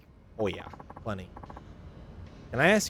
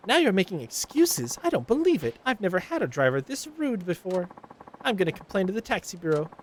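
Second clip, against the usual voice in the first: higher, scolding complaint; nagging Karen; 9 semitones higher than usual. Acted. Karen passenger scolding the taxi driver, higher nagging voice. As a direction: nag the driver for being late. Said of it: "This is a Karen".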